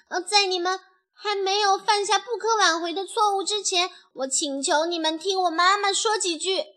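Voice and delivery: put-on high, earnest child-character voice; young chick pleading.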